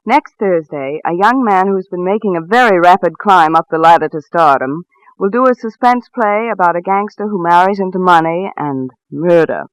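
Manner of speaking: ordinary delivery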